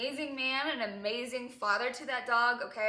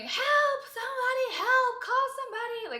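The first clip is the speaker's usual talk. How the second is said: much higher, loud panicked cry for help; retold screaming for help; 8 dB louder than usual. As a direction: scream it again, reliving the panic. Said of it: "because I'm screaming".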